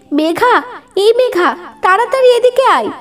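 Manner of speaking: urgent call, rising high; mother calling her daughter in alarm; urgent mock shout from mother; mom shouting. A narrator's reading. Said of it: "আম্মু চিৎকার করে আমাকে ডেকে বলছে".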